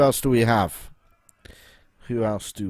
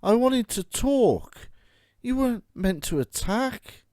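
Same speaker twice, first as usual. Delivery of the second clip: dismayed protest; 11 semitones higher than usual. Real, not acted. Player complaining the villagers attacked instead of talking. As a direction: plead with the game it wasn't meant to be.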